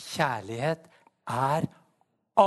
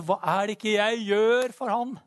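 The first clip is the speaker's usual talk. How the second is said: self-satisfied thought; 6 semitones higher, 4 dB louder than usual. Retold or imitated. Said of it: "Jeg bare tenkte".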